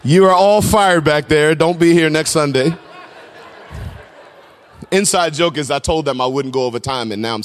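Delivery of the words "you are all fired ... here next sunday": mock firing. Preacher jokingly fires the people back there, mock scolding. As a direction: bellow a joking dismissal at your team.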